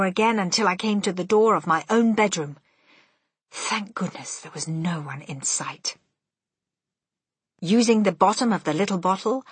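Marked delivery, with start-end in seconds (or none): none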